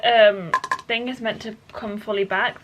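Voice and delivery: ordinary talk, nothing marked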